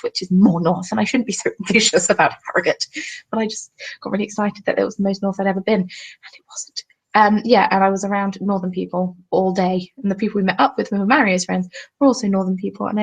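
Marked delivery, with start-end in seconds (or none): 5.8-6.8 breathy unvoiced whisper. Real, not acted. To viewers about Harrogate, a sheepish whisper. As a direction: drop to a whisper as an aside. Deflated aside after excitement.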